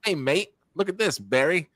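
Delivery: mock Aussie bloke, hey mate; lightly voiced Australian workmate calling out; Australian accent. Workmate calls Barry back to work, mock Australian accent. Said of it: "My Australian accent turns into".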